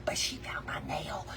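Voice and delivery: hushed whisper to viewers. Real, not acted. Whispering to viewers about the found nail. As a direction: drop to a secretive whisper.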